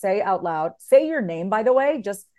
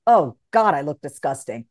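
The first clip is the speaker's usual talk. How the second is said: self-disgust; 7 semitones lower than usual. Pretend. Harsh self-talk in the mirror, disgusted. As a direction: sneer at your reflection.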